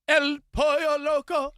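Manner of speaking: high, loud, drawn-out flourish; hammed-up restaurant name. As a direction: stretch it out big and high like an announcement.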